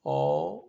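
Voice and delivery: "او" held long, as in the start of early; Turkish dotted O. Shown as a demonstration. Teacher shows O with two dots, "او" held long. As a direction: draw out "او" as one changed O. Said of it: "مختلف انداز میں استعمال کرتے ہیں".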